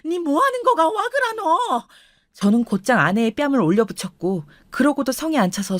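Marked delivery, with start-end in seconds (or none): 0.1-1.9 high, alarmed grandmother's cry in dialect; shrill dialect wail, pitch way up; piercing shocked cry. Acted. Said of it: "할머니의 외마디가 크게 찢어질 듯 들렸어요".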